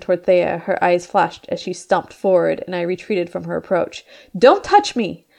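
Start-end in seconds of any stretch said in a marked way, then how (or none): 4.4-5.3 high, loud frightened cry; cornered animal. Acted. Mina recoiling from Thea, frightened cry. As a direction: shrink back and shout. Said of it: "like a cornered animal".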